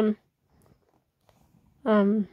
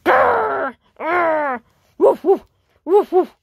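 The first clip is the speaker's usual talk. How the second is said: loud barky woofs after a growl; dog growling and barking; 6 dB louder, slower than usual. Acted. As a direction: bark like an angry dog. Dog growls and barks again.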